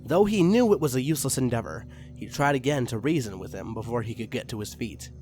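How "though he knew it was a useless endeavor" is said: plain reading voice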